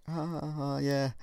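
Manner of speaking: imitating her laugh, high and soft; high, light pretend giggle into a yeah; mock laughter. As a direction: act out an amused listener's soft laugh. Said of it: "we started laughing".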